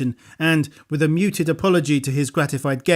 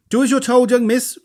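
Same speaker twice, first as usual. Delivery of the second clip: loud mock-stern order; roared; 9 semitones higher, 5 dB louder than usual. A narrator's reading. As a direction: bark it out playfully. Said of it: "roared Bones".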